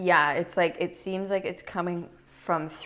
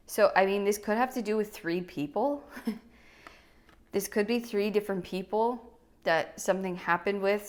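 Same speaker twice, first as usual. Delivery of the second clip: ordinary talk, nothing marked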